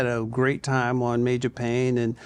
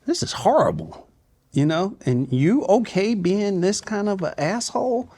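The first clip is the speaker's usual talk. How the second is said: incredulous; 6 semitones higher than usual. Retold or imitated. Mocking a toxic actor.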